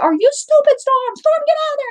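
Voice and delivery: high, exasperated scolding. Retold or imitated. Owner scolding cat in armadillo hole, panicked and high.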